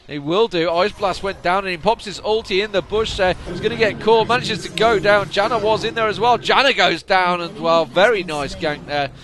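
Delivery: loud, high, fast-rising commentary shout; excited play-by-play. Real, not acted. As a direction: call the gank at full volume.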